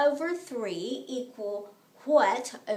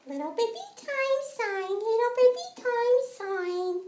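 put-on higher, cooing baby voice; little baby voice; 7 semitones higher than usual. Shown as a demonstration. Teacher repeating the step in baby voice. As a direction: coo it like a tiny baby. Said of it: "you say it in a little baby voice".

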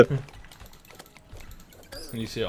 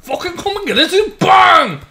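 high, very loud yell; full-volume gamer taunt, pitched way up; taunting shout; 12 dB louder than usual. Real, not acted. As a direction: bellow a challenge at an opponent. Taunting shout during a game.

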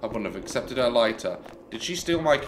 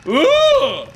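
sudden loud high-pitched squeal; startled high squeal; 8 dB louder than usual. Real, not acted. Gamer jumping at a statue, loud squeal. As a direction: squeal as it jumps at you.

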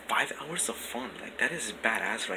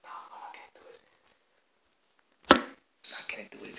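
whispering. Real, not acted. Whispering at night in the house.

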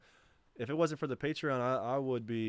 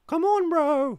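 shouted much higher and louder; 13 dB louder, much slower than usual. Acted. Streamer yelling high at his friend to come on. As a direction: yell it up high like a whine. Shouting at chat, mock pleading.

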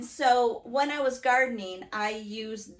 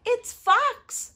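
very high little-creature voice; chirpy mouse; 11 semitones higher, 9 dB louder than usual. Acted. Mouse names who is coming, high and chirpy. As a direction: pipe it up high.

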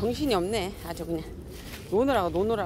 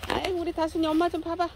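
high cooing; 9 semitones higher, faster than usual. Real, not acted. Cooing at cats.

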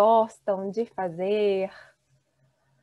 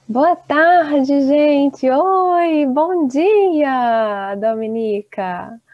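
loud greeting; 3 semitones higher, 9 dB louder, much slower than usual. Real, not acted. Teacher opens online class greeting.

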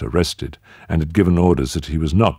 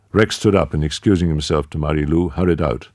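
usual speaking voice

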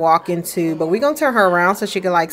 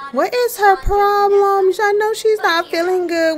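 put-on baby-talk squeal; 11 semitones higher than usual. Mother cooing high at her baby. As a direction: coo it up high at the little one.

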